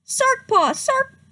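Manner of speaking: high, loud chant of a name; clan shouting. Acted. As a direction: cheer the name. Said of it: "shouted the clan".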